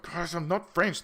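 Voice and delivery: hammed-up, high-pitched complaint; teased man protesting. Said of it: "people just tease him".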